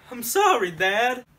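ordinary delivery

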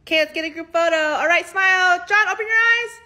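loud bossy host. Acted. Host mother directing the group photo, loudly and high. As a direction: call out like a pushy photo-taker.

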